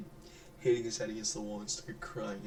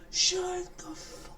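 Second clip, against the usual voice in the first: high, loud, slow mock scream at a child; hammed-up yell of a fed-up parent; 6 dB louder, much slower than usual. Parent mock-screaming at a crying kid in a store, hammed-up shriek. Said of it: "You can't just scream at him".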